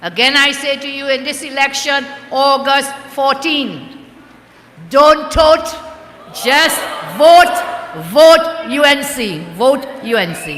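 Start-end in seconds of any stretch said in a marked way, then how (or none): 4.9-10.6 loud, punchy campaign slogan; emphatic and raised, with breaks between phrases; rally slogan. Real, not acted. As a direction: drive the slogan out to the crowd.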